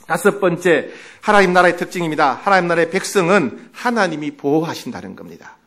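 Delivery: usual speaking voice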